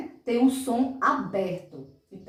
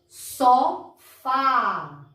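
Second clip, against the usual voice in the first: "Sofá" louder, split into so and a long fá; stressed open syllable; 2 semitones higher, 5 dB louder than usual. Shown as a demonstration. Teacher repeats for children, loudly stretching "fá" in "Sofá". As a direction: break "Sofá" apart and hold the open á. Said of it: "som aberto no fá".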